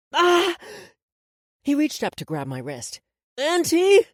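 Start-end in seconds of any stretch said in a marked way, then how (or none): 0.1-0.6 loud, high cry of pain; sudden high yelp. A narrator's reading. Boy whose hair is yanked back, pained yelp. 3.4-4.1 loud, very high plea for help; alarmed cry. A narrator's reading. Boy in a grip calls his aunt, alarmed.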